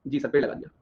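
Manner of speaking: low, soft echo as the class; students' dutiful reply. Acted. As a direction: answer back quietly as an agreeing student. Echoes as the agreeing students. (छात्रों की तरह धीमी, नीची आवाज़ में हामी)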